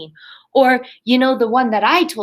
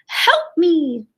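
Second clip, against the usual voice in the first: screeched; 7 semitones higher than usual. Acted. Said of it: "Clevis screeched".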